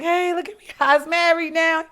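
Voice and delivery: high and loud, showing off a surprise; excited announcement. Pretend. She reveals her marriage to the world, high and loud. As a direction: throw it out loud and high like big news.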